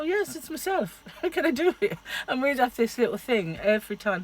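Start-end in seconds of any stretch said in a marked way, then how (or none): 0.0-2.0 put-on high slowish Irish accent; lilting Irish reply. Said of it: "gorgeous accent".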